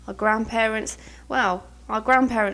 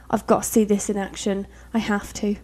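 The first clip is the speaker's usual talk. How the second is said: usual speaking voice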